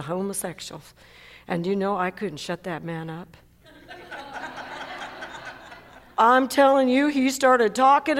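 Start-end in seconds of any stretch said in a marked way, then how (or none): 3.9-5.7 light, airy burst of real laughter. Real, not acted. Preacher laughing at his own story, quiet and airy. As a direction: laugh at the memory.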